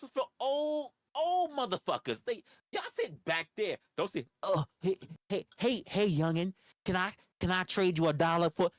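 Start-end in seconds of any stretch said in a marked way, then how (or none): 4.4-8.7 a light put-on voice of an old man pestering kids for a trade; mock old-timer begging. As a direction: play the pesky old man asking the youngin for a favour, half-mocking. Mocking old man begging on bus.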